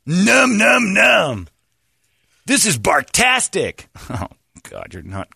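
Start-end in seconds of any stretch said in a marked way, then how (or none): 0.0-1.5 high, loud munching noises; silly dog voice. Pretend. Voicing dog eating. 2.5-3.8 high, slow doggy delight; silly dog voice. Pretend. Voicing the dog.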